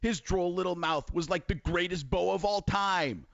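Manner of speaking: Ron Jaworski impression. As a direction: gush about the tape. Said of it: "Ron Jaworski".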